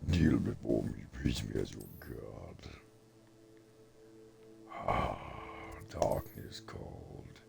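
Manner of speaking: mock dark lord proclaiming himself god. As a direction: growl it like an evil overlord demanding worship. Dark lord boast while gaming.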